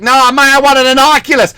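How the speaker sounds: high, protesting boy impression; insistent kid. Boy correcting his dad, high mock protest. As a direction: whine back as a mock little boy. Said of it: "He's like".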